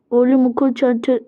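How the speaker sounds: ordinary talk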